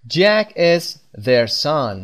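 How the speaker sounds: usual speaking voice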